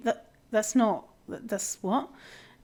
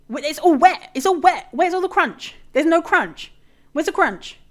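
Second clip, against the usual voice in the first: exasperated rant; 7 semitones higher, 7 dB louder than usual. Real, not acted. Ranting about soggy dinners.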